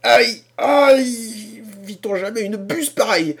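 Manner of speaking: high, loud pained outrage; groaning in rage. A narrator's reading. The wounded old man groans and berates Harrison. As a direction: wail and scold at once. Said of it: "en poussant des gémissements".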